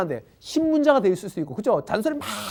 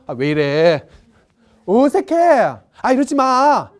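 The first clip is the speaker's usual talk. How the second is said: awkward recoil; 2 semitones higher, 7 dB louder, slower than usual. Acted. Friend's touch rejected, acted.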